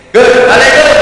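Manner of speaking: high shouted salam, rising. Shown as a demonstration. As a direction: holler it at the teacher, pitch way up. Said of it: "ono santri ketemu Gus Heri".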